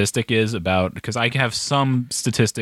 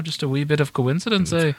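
lilting, higher-pitched Irish Canadian put-on; Irish Canadian accent; 4 semitones higher than usual. Host jokes about Irish downloads being coincidence, Irish Canadian accent. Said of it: "that was Irish Canadian there".

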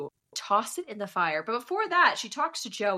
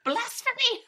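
high-pitched pretend horror; hammed-up religious indignation; mock outrage; slower than usual. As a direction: cry it out in playful scandalized protest.

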